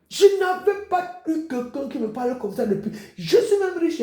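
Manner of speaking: a touch of a grateful brother, voice lifting high; playing the brother touched by the sister's words; moved brother. Preacher voicing a grateful brother, lifting high with a laugh.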